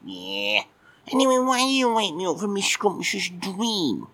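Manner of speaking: high, squeaky grumpy cat puppet just woken; a sleepy, put-out little puppet admiral; cheeky puppet cat. Acted. Puppet cat grumbling at Mike for waking him, squeaky.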